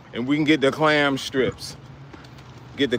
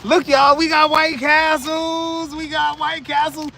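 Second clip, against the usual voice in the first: excited shout; 11 semitones higher than usual. Real, not acted. Excited showing his food.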